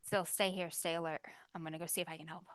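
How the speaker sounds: quiet. Acted. Rue calms sister quietly.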